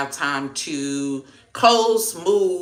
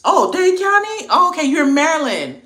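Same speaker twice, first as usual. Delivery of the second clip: much higher, louder oh; surprised reaction; 12 semitones higher, 8 dB louder than usual. Real, not acted. Reading a viewer's answer in chat, surprised.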